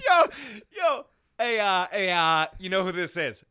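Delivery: lightly voiced rambling caller; imitating the caller. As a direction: mimic the vague jailhouse caller. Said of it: "He doesn't even tell me his name".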